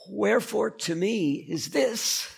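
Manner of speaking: put-on high, slow old-English recitation; mock Shakespearean. Shown as a demonstration. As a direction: intone it like a Shakespeare line. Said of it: "sounds like Shakespearean English".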